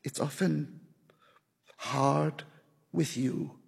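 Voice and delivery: soft, halting words with long pauses. A narrator's reading. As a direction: speak softly and hesitantly. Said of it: "she said softly".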